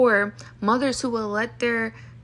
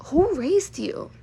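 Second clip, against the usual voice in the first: higher, slow, drawn-out disbelief; incredulous retort; 6 semitones higher, much slower than usual. Real, not acted. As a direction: stretch it out, genuinely appalled. Indignant retort to rude people.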